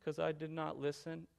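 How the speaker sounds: very soft, sheepish little admission; small, subdued reply to a parent; chastened child. Retold or imitated. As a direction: imitate a child owning up, hushed. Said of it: "He looks at me like this".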